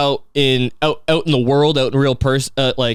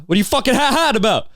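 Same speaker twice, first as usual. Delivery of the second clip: mock indignant; 9 semitones higher, faster than usual. Indignant reply to a dry texter.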